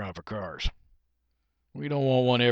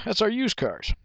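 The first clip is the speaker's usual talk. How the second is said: higher-pitched salesman voice; 8 semitones higher than usual. Acted. Salesman answering a car shopper, pitched-up sales voice.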